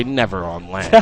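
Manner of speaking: lightly voiced nerdy gamer scoff; a hint of a whiny nerd. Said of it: "a little nerdy voice".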